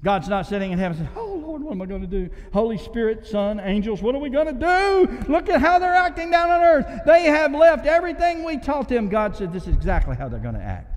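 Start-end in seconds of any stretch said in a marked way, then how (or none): 1.2-9.1 high, loud, panicked mock heavenly voice; mock fretting God. Preacher mocking a God who panics over mankind. 9.5-10.9 calm knowing God. Pretend. God's calm answer.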